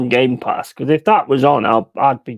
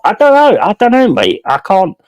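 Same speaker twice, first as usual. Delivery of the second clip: loud, high exasperated I don't know; exasperated outburst; 6 semitones higher, 6 dB louder than usual. Real, not acted. Streamer baffled by a lazy player, loud exasperation. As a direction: throw up your hands, loud and baffled.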